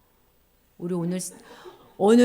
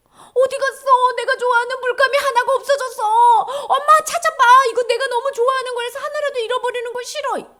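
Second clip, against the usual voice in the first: put-on high, loud whining child demanding mom find it; whiny child; 14 semitones higher, 9 dB louder than usual. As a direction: wail like a kid who lost a favorite crayon. Child whining for lost paint.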